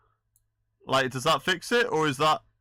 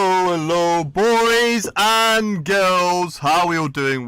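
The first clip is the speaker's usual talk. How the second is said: big, slow, booming hello; loud greeting; 3 semitones higher, 14 dB louder, slower than usual. Real, not acted. Streamer greeting his viewers, loud and drawn out. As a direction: welcome the chat like a showman.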